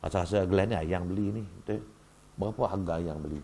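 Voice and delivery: lakonan suami memujuk isteri dengan suara rendah; suami minta maaf. Acted. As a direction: pujuk isteri lembut, suara semakin rendah. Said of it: "Pernah tak tanya isteri dia". (memujuk lembut)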